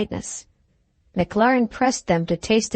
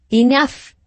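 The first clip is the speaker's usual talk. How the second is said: loud, higher burst of anger; angry cry; 5 semitones higher, 5 dB louder than usual. Acted. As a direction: cut him off with a loud angry shout. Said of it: "he cried".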